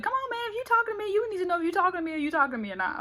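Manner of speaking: high impatient rush; fast high exasperated demand. Retold or imitated.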